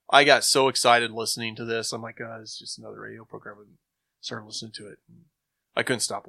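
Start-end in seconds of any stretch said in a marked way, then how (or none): none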